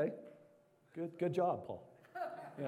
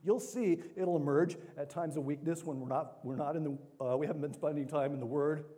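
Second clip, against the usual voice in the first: usual speaking voice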